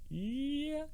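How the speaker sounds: high, quiet squeaked-out yeah; awkward agreement. Retold or imitated. New worker agreeing that it's not good, awkward. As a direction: squeeze out a tiny high-pitched assent. Awkward reply to bad news.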